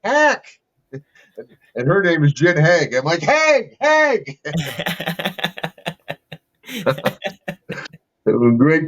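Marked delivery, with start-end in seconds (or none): 0.0-0.4 high-pitched calling out; mock yell, pitched way up; imitated yelling. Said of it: "she's yelling at me". 3.2-4.4 high-pitched holler of a name; imitated shout, pitched way up; retold calling out. Acts out yelling back her name.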